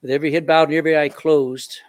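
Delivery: earnest pastoral prayer, swelling and fading. Acted. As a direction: pray aloud over the bowed congregation. Pastor leads closing prayer.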